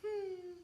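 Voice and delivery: soft, very high whimper; short high pre-cry whine; demonstrated whimper. Shown as a demonstration. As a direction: give a tiny puppy whine. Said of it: "I did whimpering".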